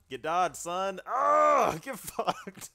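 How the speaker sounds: loud, high taunting yell; trash-talk yell. Real, not acted. Gamer yelling taunts at the enemy. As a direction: crow over the dodge.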